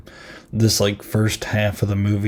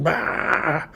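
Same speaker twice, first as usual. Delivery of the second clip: mock Shining shout; 7 semitones higher, 4 dB quieter than usual. Imagined axe through the door.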